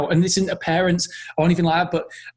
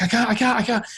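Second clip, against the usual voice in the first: mock-panicked child, pitched up; a parent's re-enactment of child panic; imitated panic; 7 semitones higher than usual. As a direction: act out a small boy panicking, higher and repetitive. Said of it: "he was having a panic attack".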